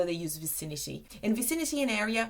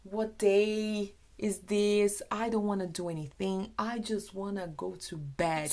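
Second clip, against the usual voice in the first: mock exhaustion. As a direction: sound utterly worn out, dragging the words. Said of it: "So you can say".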